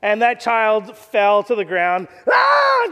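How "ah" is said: put-on child's cry. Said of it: "just started crying".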